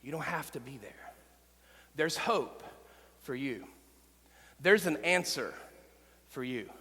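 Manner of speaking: quiet, tender reassurance to the struggling listener; soft, tender reassurance. Real, not acted. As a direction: speak softly straight to one hurting listener. Pastor gently addresses the suicidal listener.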